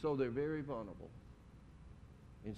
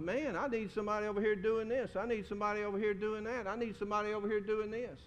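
overwhelmed lone worker; 7 semitones higher, faster than usual. Retold or imitated. Preacher voicing overwhelmed lone worker.

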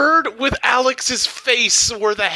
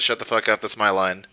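low gruff Sam; 10 semitones lower, faster than usual. Retold or imitated. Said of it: "and Sam says".

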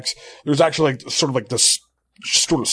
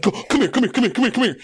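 urgent calling; 8 semitones higher, faster than usual. Retold or imitated. Calling friend over.

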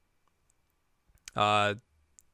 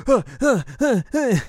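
high, loud panting huhs; panicked huffs; 13 semitones higher, 7 dB louder than usual. Retold or imitated. Inuyasha hyperventilates as she leans in, high panting huffs. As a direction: pant in mock alarm. Panicked breathing in retold scene.

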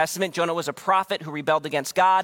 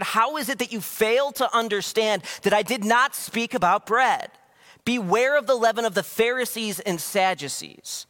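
higher, raised rebuke; angry rebuke; 6 semitones higher than usual. Retold or imitated. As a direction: scold them, exasperated. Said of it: "Jesus starts to get a little angry".

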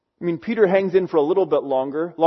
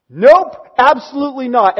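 "Nope" higher and loud, "absolutely not" firmly stressed; emphatic denial; 6 semitones higher, 6 dB louder than usual. Retold or imitated. Peter denying he knows Jesus, emphatic. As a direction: shut it down with a loud, high "Nope". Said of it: "But he's emphatic".

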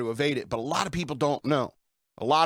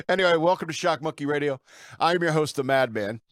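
ordinary delivery

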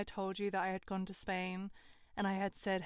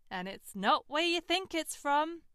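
higher, louder taunting; smug boyfriend quizzing her, louder; smug mocking boyfriend; 7 semitones higher, 9 dB louder than usual. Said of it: "he responds".

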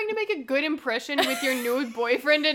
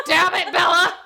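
louder mock curse with a laugh; hammed-up angry exclamation; mock angry outburst; 5 dB louder than usual. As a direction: imitate a furious boyfriend, amused. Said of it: "he's super angry".

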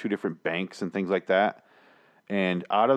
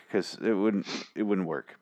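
normal talking voice

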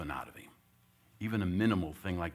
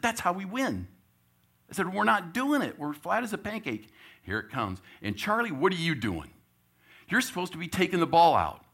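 much higher and louder angry coaching; coach chewing out; 10 semitones higher, 10 dB louder than usual. Retold or imitated. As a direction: yell at the team to do their job. Coach chewing out player and team.

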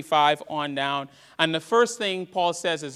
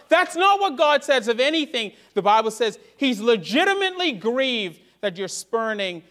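preaching at full force; 5 semitones higher than usual. Acted. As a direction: lift it up to the congregation.

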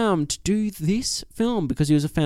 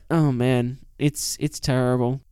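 normal talking voice

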